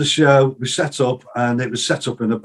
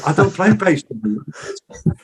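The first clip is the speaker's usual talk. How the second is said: slow, drawn-out higher protest; mock protest; 5 semitones higher, much slower than usual. Refusing Denny's bass offer, slow mock protest. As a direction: spell it out slowly in disbelief.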